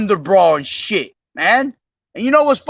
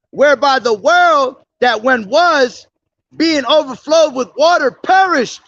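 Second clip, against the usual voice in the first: preaching scripture; 5 semitones higher than usual. Acted. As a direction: lift each phrase higher as you proclaim. Reads Second Peter preaching.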